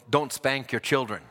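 usual speaking voice

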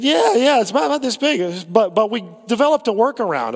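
proud of their clever fix; imitating the youth leader.

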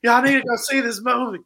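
high, loud hyped call to friends; excited shout. Retold or imitated. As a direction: shout the news to everyone. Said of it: "screaming from the rooftops".

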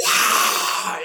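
breathy shouted wow. Retold or imitated. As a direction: call it out to the crowd. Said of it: "he just comes on and goes".

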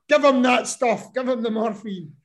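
mock-plead for the drugs; imitating his wife. Wife urging the paramedics to give him morphine, hammed-up insistence.

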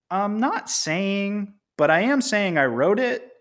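high, drawn-out coy hinting; mock coy hedging. Host voices writer posting the last panel, coy high drawl. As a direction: stretch it out high, hinting without admitting.

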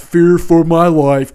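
slow, scornful mock yell; mock all-caps read. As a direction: read it like a sarcastic shouted caption. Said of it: "And then the reverse caps".

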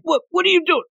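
high-pitched mock indignation; mad outburst. Bakugo finds Todoroki in the room, mock mad. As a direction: act out flustered irritation, pitched right up. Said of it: "gets even more mad".

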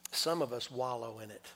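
usual speaking voice

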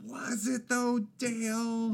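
high put-on voice, stretched words; drawn-out teasing doubt. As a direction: needle him slowly in a high voice.

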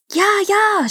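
high, eager cry; shrieking demons. A narrator's reading. Demons agree to the prince's contest, shrieking. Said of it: "schrien die Dämonen".

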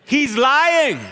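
put-on high, loud child's call; tattling older brother. Older brother telling on his little brother, loud high call. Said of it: "the voice of his dutiful older brother".

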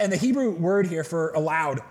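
ordinary talk, nothing marked

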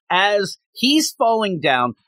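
normal talking voice

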